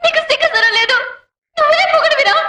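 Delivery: high-pitched, indignant rebuke; angry scolding. Acted. Wife shaming her husband for laughing, high and indignant.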